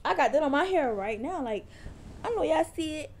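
high, showy teasing voice; playful bragging. Pretend. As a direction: show off the curls, teasing. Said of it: "I'm joking".

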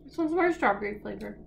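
talking with mouth full; speaking between chews; chewing candy. Real, not acted. Seen 1: woman bit pink candy at -9, talks while chewing.

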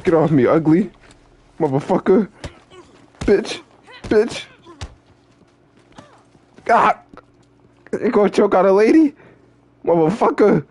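high angry screaming character; furious high shriek. Acted. Choked by an ugly attacker, shrieking rage.

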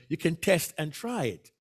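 ordinary talk

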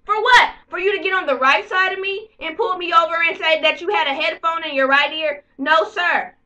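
loud, high indignant yell; outraged shouting at the officer; angry protest. Retold or imitated. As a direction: blast it back at him. Arguing back at the cop.

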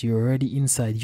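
ordinary delivery

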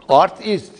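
"Artist" split as art-ist, loud and clear; teacher's split "Artist"; word broken into parts. Shown as a demonstration. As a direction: say "Artist" in two separate pieces, art and ist. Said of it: "Art İngilizce sanat demek".

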